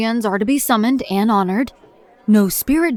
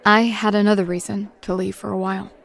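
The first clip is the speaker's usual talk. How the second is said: ordinary talk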